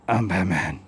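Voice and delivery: very low, soft Batman rumble. Retold or imitated. Showing the Batman voice against the criminal one, low Batman rumble. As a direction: drop way down into a hushed Batman. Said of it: "And Batman sounds like this".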